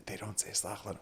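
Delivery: very soft, discreet aside; retold whisper. Rabbi quietly correcting the guest speaker, retold in a whisper. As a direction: whisper it privately, re-enacting the moment. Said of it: "I whispered to him, I said".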